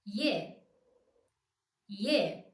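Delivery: I and E glided as ye; ye as one sound. Shown as a demonstration. Teacher modelling ye for learners as one diphthong. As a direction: glide Y straight into E. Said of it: "I plus e".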